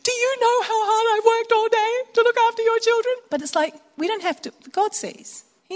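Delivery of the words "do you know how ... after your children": much higher, strained complaint; exasperated mum. Pretend. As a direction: let the frustration of a long day burst out. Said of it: "you sometimes feel like saying".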